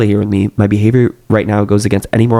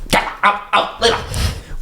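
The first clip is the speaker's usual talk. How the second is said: high, breathy, drawn-out plea; desperate plea; 11 semitones higher, 5 dB quieter, much slower than usual. A narrator's reading. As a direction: stretch it out, high and thin. Desperate repeat of the command.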